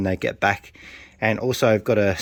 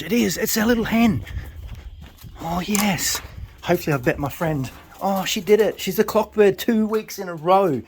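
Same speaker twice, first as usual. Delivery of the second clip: high, thrilled cries of joy; overjoyed as she lands; excited squeal; 8 semitones higher than usual. Real, not acted. First pigeon lands, thrilled.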